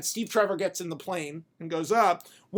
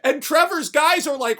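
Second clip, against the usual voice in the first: usual speaking voice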